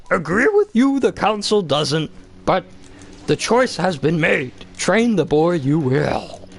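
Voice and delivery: high, slow, squeezed Yoda croak with inverted phrasing. Retold or imitated. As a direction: do the little green master, drawn out and pinched. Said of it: "But he's like".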